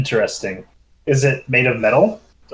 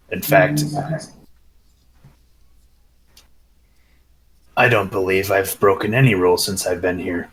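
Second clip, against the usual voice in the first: lowered [name] voice; 5 semitones lower than usual. Acted. [name] speaks in character.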